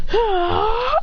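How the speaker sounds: high, play-acted charging cry; battle yell. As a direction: cry out as if throwing a punch. Battle cry before a punch.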